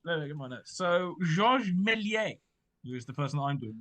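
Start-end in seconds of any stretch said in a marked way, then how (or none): 0.8-2.4 put-on slow, drawn-out French accent. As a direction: say Méliès with an outrageous French accent. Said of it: "in an outrageous French accent".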